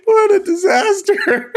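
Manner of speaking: very high, squeaky real laughter; laughing to tears. Real, not acted. Host wrecking the broadcast, laughing until crying. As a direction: squeal it out through helpless laughing. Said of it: "Okay, I'm crying".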